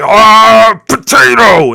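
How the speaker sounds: very high, loud delighted cry; mock thrilled shout of potato; excited exclamation. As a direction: burst out with joy at the word. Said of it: "They'd be like".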